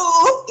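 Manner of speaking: a loud, high sudden "uh" as the words jam; sudden blocked burst. Retold or imitated. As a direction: show the words crashing out at once. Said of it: "es como si todas las palabras quieren salir de golpe". (un "uh" fuerte y agudo, como palabras atascadas)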